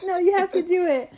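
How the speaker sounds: high, loud imitation of a pushy friend; insistent friend. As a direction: voice the friend insisting, pitched right up. Friend insisting she go talk.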